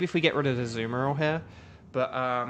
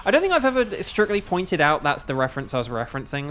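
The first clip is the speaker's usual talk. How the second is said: normal talking voice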